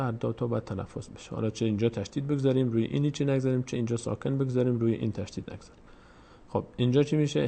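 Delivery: normal talking voice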